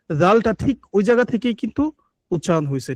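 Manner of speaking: usual speaking voice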